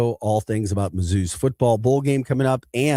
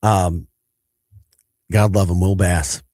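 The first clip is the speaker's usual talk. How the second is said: ordinary delivery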